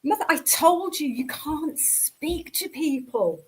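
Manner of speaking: slow, drawn-out mock scolding; mock reprimand, dragging out the words; imitating exasperated son. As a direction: spell it out to an embarrassing parent. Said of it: "he's like".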